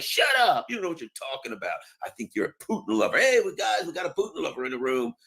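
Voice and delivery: high snap, then fast dismissive rant; mock outraged official. Officials shouting down the dissenter, high snap then fast. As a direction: shut him down and call him a Putin lover. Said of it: "They're like".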